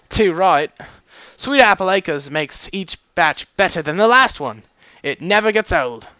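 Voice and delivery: put-on wavering Australian accent. Coconut praising the cider to a friend, fading Australian accent. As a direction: put on a slightly unsteady Aussie accent. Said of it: "My, my Australian accent was fading".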